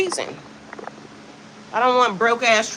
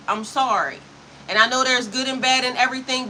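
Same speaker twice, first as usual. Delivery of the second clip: ordinary talk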